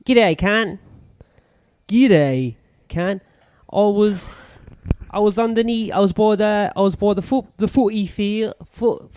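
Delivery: high-pitched put-on Aussie accent; halting attempt at an Australian accent; badly faked Australian, stumbling over the words. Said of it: "my Australian version".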